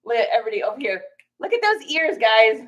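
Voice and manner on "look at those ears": cooing pet names. Real, not acted. Cooing at dog.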